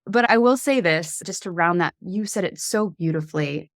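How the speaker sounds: ordinary talk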